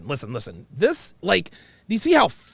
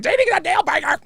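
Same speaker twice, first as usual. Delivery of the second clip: high, loud cartoon character voice; exaggerated high-pitched comic impression; Fire Marshal Bill; 5 dB louder than usual. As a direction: squawk it out like a manic cartoon warning. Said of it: "you sound like the Jim Carrey character".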